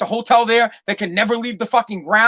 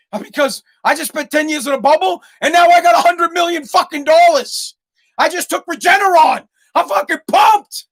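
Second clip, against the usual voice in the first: higher, louder, giddy imagined boast; mock ecstatic; 6 semitones higher, 4 dB louder than usual. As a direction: gloat like a rich man fresh out of the bubble. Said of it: "Why are you so happy?".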